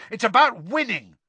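high, loud contradiction; heated yell; angry shout. A narrator's reading. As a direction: shout the objection down. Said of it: "shouted Ron".

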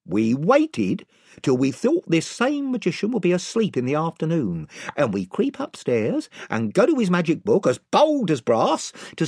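rambling, self-justifying storyteller; gang chief telling his tale. Acted. Chief tells his long tale.

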